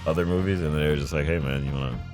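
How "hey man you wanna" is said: ordinary talk, nothing marked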